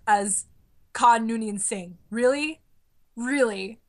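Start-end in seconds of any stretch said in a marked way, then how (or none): 2.1-2.6 higher, rising disbelief; incredulous question. Acted. Fan questioning the casting of Khan, incredulous. Ranting about odd casting choice. 3.2-3.8 deadpan disbelief. Acted. Fan doubting the casting of Khan, deadpan.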